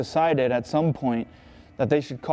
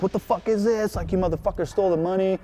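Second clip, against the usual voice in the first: higher-pitched mock furious demand; retold angry outburst; 5 semitones higher than usual. Guy storms in over the stolen money, retold angry outburst. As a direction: imitate an angry guy bursting in.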